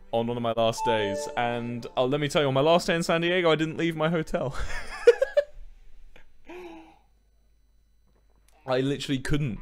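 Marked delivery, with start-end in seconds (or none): 4.6-6.2 breathy high laugh. Real, not acted. Laughs at own story.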